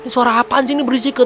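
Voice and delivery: high-pitched, fast grumble about noise; mock irritated father. Ayah menegur keributan dalam cerita. (ayah kesal, nada tinggi dan cepat)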